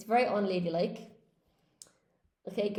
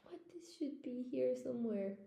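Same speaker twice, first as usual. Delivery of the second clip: whispered while searching the book; breathy whisper, talking to herself; 10 dB quieter than usual. Real, not acted. Teacher hunting through her book: whispered aside.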